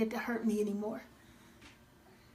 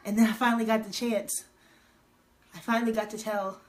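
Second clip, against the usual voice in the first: usual speaking voice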